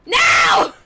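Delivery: high, loud, laughing no; shouted refusal. Real, not acted. Killer yelling no at the hook carry. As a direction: yell it in protest.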